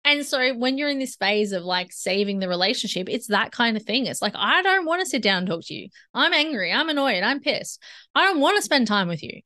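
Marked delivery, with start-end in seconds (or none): 4.3-9.4 higher, raised voice of an annoyed, angry partner. Acted. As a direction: vent like a fed-up spouse who refuses to talk.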